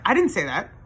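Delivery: mildly heated retold protest; imitated indignant denial. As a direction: imitate an ex insisting on innocence. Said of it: "like, the crazy eyes again".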